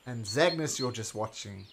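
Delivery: normal talking voice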